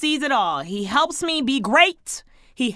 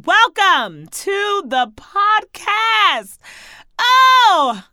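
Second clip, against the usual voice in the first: excited welcome; 9 semitones higher, 9 dB louder, much slower than usual. Real, not acted. Hyped welcome to listeners.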